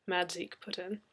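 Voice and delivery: plain reading voice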